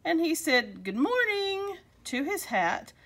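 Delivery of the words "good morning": high, drawn-out sing-out greeting; cheerful greeting. A narrator's reading. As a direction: greet the pets brightly, pitched well up. Cheerful greeting to hat in story.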